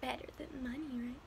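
secretive, voiceless whisper; sly whisper. Retold or imitated. Stranger tempting Jack with beans, sly whisper. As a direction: lean in and whisper the deal. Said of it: "he's like".

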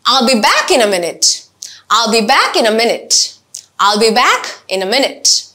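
I'll be back in a minute, stressed on back, falling, slow; falling tune. Shown as a demonstration. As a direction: say it slowly, dropping after back. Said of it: "প্রত্যেকটাকে তিনবার করে করে বলবো".

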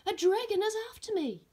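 a fleeing lion begging to be hidden; panicked lion. Acted. Frightened lion bursts in.

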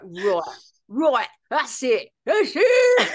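hammed-up fury with a twisted mouth; contorted road rage. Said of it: "It's this contortion that happens".

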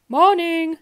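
loud, high holler; booming morning call; shouting postman. A narrator's reading. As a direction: shout a greeting at the door. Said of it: "shouted the postman".

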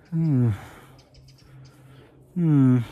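ordinary delivery

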